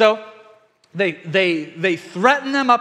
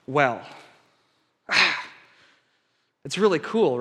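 ordinary delivery

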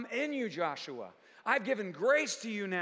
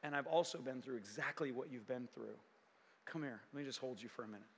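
much softer and lower, embracing tone; tender Jesus; 9 semitones lower, 11 dB quieter than usual. Acted. Jesus comforting a hurting believer, hushed and tender. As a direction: hold them close with your voice. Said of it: "he can say".

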